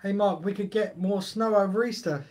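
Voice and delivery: ordinary talk, nothing marked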